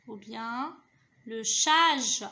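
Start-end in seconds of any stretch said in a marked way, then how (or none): 1.3-2.3 "chage" loud, with a long held vowel; joining syllables. Shown as a demonstration. Teacher testing a possible word, "chage" firmly sounded out. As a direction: project and stretch "chage". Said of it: "Je relis les syllabes".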